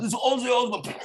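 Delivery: a light take on [name]'s voice; [name] impression. Comic pitching a radio intro in [name]'s voice. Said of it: "a radio intro".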